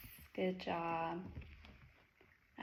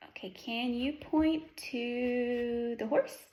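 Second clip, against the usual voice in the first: put-on reading to a toddler. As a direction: coax a toddler patiently. Teacher quizzing toddler on animals.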